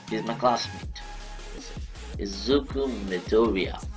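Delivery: ordinary delivery, nothing marked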